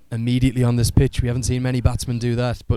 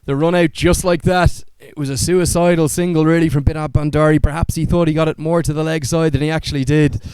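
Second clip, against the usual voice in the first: raised, lively call of a run out; excited cricket commentary; 4 semitones higher, 4 dB louder than usual. Real, not acted. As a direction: call the wicket with rising excitement. Live play-by-play of run out.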